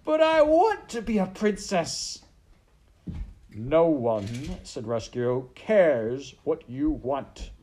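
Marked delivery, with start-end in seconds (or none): 0.0-2.2 loud, very high tantrum wail; wailing serving girl. Acted. Maid protesting to the rat, high loud wail. 3.5-4.3 loud, emphatic put-down; cruel rat. Acted. Rat dismissing the maid's wish, loud and scornful. 5.5-7.5 loud, slow, higher put-down; cruel rat. Acted. Rat dismissing the maid's wish, slow loud scorn.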